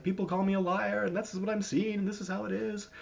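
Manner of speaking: high mock-tearful plaint; imitating crying woman. Crying woman confiding after being called a liar, imitated high. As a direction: plead in a put-on high, tearful voice. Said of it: "she was all crying".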